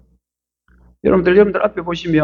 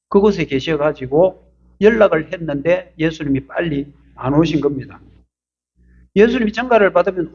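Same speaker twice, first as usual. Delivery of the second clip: normal talking voice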